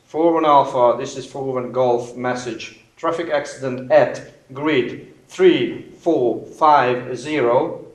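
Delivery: steady slow radio voice; calm radio call. Shown as a demonstration. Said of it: "And here it comes".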